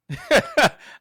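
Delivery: high, loud delighted laugh; burst of laughter. Real, not acted. Preacher to audience, laughing at his own punchline. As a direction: laugh out at your own line.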